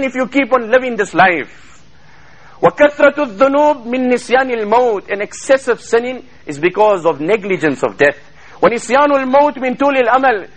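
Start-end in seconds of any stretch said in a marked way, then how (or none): 2.6-5.1 rapid chanted Arabic quotation; Arabic recitation. Acted. 8.6-10.5 rapid chanted Arabic quotation; Arabic recitation. Acted.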